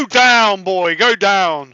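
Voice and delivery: loud urging call. Pretend. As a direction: go down boy, slow and loud. Urging like a dog.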